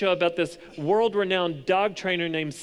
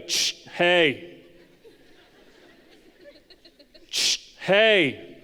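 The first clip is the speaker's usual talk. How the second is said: sharp dog-trainer correction; loud hush, then a firm "hey"; Cesar Millan; 2 semitones higher, 5 dB louder, much slower than usual. Acted. Preacher imitating a trainer correcting a dog, sharp command. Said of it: "look at the dog and go".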